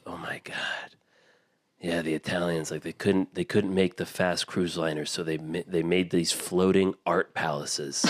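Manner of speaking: whispered start, then kept soft and low; hushed captain. Retold or imitated. Captain marvels at the ship, hushed and soft. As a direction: breathe it out in awe, hushed. Captain awed by the cruise liner.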